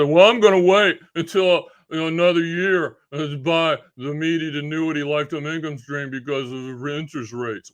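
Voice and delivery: loud, dopey put-on voice easing off; mocking procrastinator. Quoting people who wait on rates, mockingly. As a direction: parody someone stalling, starting loud then settling. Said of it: "because people say".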